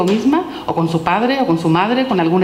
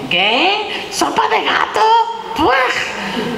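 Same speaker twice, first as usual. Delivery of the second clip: put-on much higher, a little girl's voice full of disgust; 12 semitones higher, much slower than usual. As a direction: squeal it out like a child who has just seen cat in her soup. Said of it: "dice la pobre niña". (niña asqueada con voz aguda)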